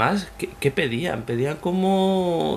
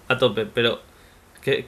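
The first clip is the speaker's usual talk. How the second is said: usual speaking voice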